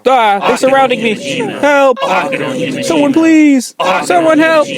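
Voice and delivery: high, loud cries for help; panicked screaming. Acted. Being attacked, calls for help.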